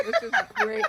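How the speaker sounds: laughing through the words; breaking up laughing mid-sentence. Real, not acted. Laughing at messy taco.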